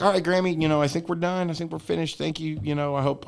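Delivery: high-pitched, soft polite excuse; polite wrap-up. Retold or imitated. Grandson telling grandma he's finished, high polite imitation. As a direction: politely try to get away, voice up.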